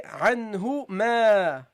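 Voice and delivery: slow, drawn-out "ma"; demonstrated recitation. Shown as a demonstration. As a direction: model it slowly, holding the final "ma". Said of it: "so you say".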